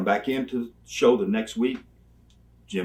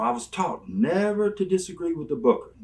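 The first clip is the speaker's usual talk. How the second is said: ordinary delivery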